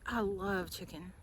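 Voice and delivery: soft, slow, breathless confession of a guilty love; whispered craving. Retold or imitated. Mother recalling her old craving, whispered. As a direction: hush it like a secret temptation. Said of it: "I was like".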